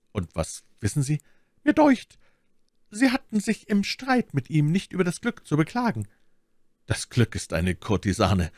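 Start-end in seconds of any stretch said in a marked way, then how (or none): 1.6-6.1 very high-pitched lady's voice; Milady needling. Acted. Milady reminds him of his luck, high-pitched. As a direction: remark pointedly in a lifted feminine voice.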